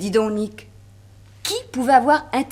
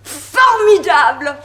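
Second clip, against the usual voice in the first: delighted exclamation; 4 semitones higher, 7 dB louder, much slower than usual. Acted. Ida discovers the musical clue.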